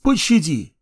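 high, desperate plea; begging in fear. Acted. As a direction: beg for mercy. Slaves beg for their lives.

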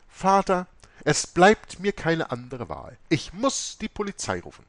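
high, loud final warning; the abbess threatening the police, high and loud; stern abbess. A narrator's reading.

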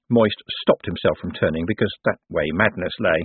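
usual speaking voice

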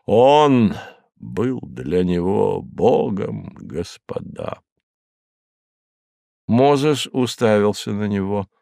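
plain reading voice

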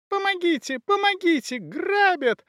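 very high-pitched woman's cries for help; shrieky, panicked alarm; bawling into squeal. A narrator's reading. Woman being robbed calls for help, squealing. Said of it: "горланила в покоях женщина, срываясь на визг".

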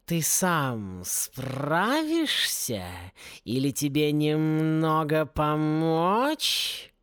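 very slow, drawn-out feline coaxing; insinuating. A narrator's reading. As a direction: draw the offer out slowly, gliding up on the question. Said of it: "вкрадчивый голос".

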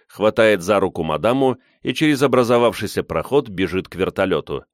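ordinary delivery, nothing marked

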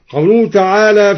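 usual speaking voice

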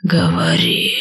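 hissing snake voice; basilisk. Acted. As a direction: hiss it like an old serpent. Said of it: "прошипел старый змей".

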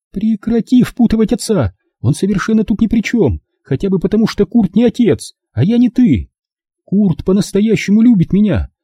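high, insistent voice, arguing; defensive daughter. Acted. Daughter defending her boyfriend to mother, defensive. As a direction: argue back high and earnest.

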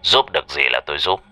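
a powerful man laying down a threat; stern director. Acted. Director Nam scolds and threatens. (giám đốc Nam giọng trầm, hách dịch đe dọa)

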